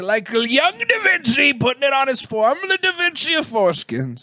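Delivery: high, hammed-up hawker voice; mock showman. Showman presenting foreskin masterpiece to folks, high and hammed-up.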